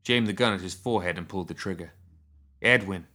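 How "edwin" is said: mildly raised voice calling a name; short, slightly raised call. A narrator's reading. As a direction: call to someone inside a wagon. Calling to him inside wagon.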